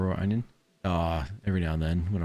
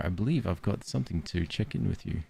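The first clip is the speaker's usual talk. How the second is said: normal talking voice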